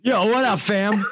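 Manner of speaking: high, loud, laughing yo; laughing mock greeting. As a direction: toss off a laughing bro greeting. Cohost mimics cool greeting.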